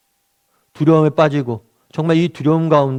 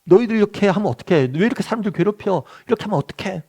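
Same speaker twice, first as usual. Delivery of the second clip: higher-pitched mock telling-off; a preacher acting out a higher, fussing rebuke; scolding; 6 semitones higher than usual. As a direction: scold the wind like misbehaving kids. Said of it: "막 혼내시는 거예요".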